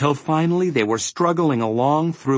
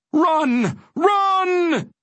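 very high, sustained cry; urgent shout. A narrator's reading. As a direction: urge them on in a high, held call. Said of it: "it urged once more".

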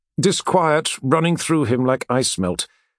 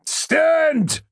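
yelled. A narrator's reading. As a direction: bark the order at the men. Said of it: "yelled Siek".

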